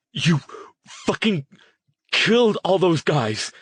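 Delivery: high, loud enraged yell. Acted. Jason shouting at Harrison over the dead men, enraged yell. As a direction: yell at him, beside yourself. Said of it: "Jason was still yelling".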